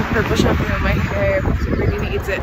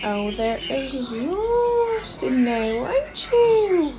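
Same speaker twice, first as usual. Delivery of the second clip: high cooing pet talk; 5 semitones higher, much slower than usual. Real, not acted. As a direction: coo to the tired dogs. Talking to the tired dogs.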